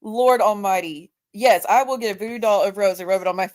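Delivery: normal talking voice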